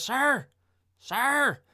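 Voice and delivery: high, loud mock shout; yelling. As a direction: call out sharply to a stranger. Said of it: "who he's yelling at".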